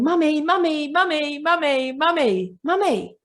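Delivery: put-on high, insistent child calling mummy; whiny child. Four-year-old child nagging mum, high repeated whine. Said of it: "four-year-old child ... going".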